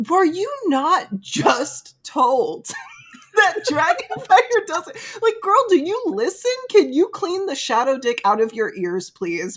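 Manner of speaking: high, loud, laughing exasperation; exasperated rant. Retold or imitated. Reader scolding Violet for not listening, high exasperated. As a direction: scold her like a fed-up best friend. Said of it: "I'm like".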